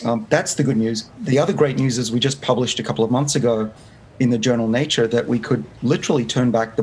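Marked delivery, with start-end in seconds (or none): none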